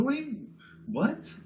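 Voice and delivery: mock stunned double take in a high voice; retold bewilderment. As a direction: act dumbfounded, pitch shooting up. Retold shock at friend's news.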